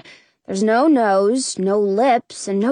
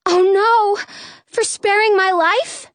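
high, horrified exclamation; shocked, distressed girl's voice; gasping dismay; 10 semitones higher than usual. A narrator's reading. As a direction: gasp it out in high-pitched alarm. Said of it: "Snow gasps".

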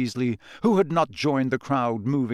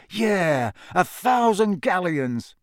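slow, drawn-out daydreaming; faraway, wistful musing, pitched up; dreamy; 6 semitones higher, 3 dB louder, slower than usual. A narrator's reading. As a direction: linger longingly on the prize. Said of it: "a faraway look on his face".

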